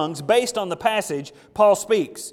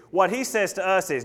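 normal talking voice

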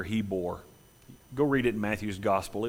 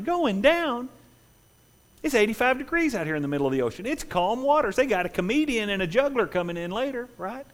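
high, fast scoffing crowd voice; scoffing partygoer; 11 semitones higher than usual. Acted. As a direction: laugh off the warning from the deck.

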